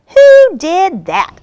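high, loud, drawn-out indignation; cross little squirrel, high and loud. A narrator's reading. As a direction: demand it crossly, stretching the words. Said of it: "asked the squirrel crossly".

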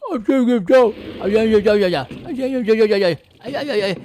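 loud garbled yeah yeah while bitten; chomped yelling. Real, not acted. Fish player yelling while the whale chomps him. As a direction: yelp through the bites.

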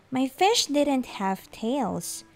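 ordinary delivery

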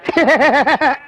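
staccato demonstration laugh; rapid, pulsing "he-he-he" laugh; show-off laugh. Shown as a demonstration. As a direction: laugh to show how it's done. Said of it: "என்ற மாதிரி ஒரு நாள் சிரிக்க முடியுமாத்தா".

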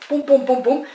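high, loud bouncy "pum" beats; climbing sound effect. Retold or imitated. Telling how the girl climbed stairs, bouncy sound effect. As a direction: punch out each pum like a step.

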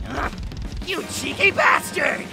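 high, drawn-out curse; angry curse. Acted. Fighter thrown into the ocean, angry curse. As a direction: curse your opponent, high and slow.

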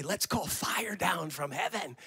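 slow conspiratorial whisper. Retold or imitated. Disciples plotting among themselves, slow whisper. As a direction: hush it like a secret plan. Disciples scheming, voiced in sermon.

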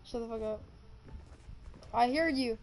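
ordinary delivery

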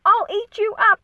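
high, loud playful monster threat; a boy pretending to be a beast; cheeky threat. Max in his wolf suit threatening his mother, playful and high. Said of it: "and Max said".

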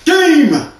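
high, loud victory shouts; excited shouting. Acted. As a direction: yell it like you just won. Video game win shouts.

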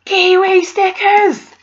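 high, loud and slowed, thrilled; delighted squeal. Real, not acted. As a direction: squeal it out with real joy. Unboxing a gift, delighted.

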